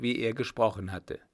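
usual speaking voice

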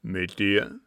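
put-on loud, gruff old captain; forceful interrogation; suspicious demand. A narrator's reading. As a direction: shoot the question at him.

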